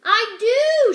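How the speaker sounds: very loud high shout. Acted. Servant girl shouting that she can whisper, loud. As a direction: blurt it out at full volume. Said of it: "shouted Mig".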